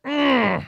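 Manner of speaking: high, loud raging groan; real anger, high and loud; furious groan. Real, not acted. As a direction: let out a loud howl of frustration. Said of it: "I'm fucking pissed off".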